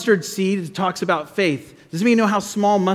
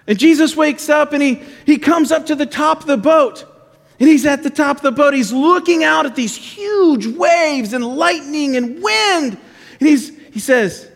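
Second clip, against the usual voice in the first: high, animated storyteller; 8 semitones higher than usual. Real, not acted. Pastor telling kids of the waves, animated storytelling. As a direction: build suspense, stretching the big words.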